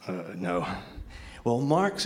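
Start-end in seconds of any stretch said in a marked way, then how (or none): 0.1-0.6 low, soft muttered admission; under the breath. Retold or imitated. Son sheepishly answering his friend's question, under his breath. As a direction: say it under your breath, much softer. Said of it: "I say under my breath".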